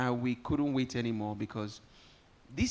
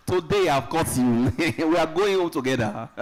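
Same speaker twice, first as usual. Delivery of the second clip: louder, laughing as she teases; teasing through laughter; 4 semitones higher, 8 dB louder than usual. Real, not acted. MC teasing the king about his promise, laughing. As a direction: laugh out the playful jab.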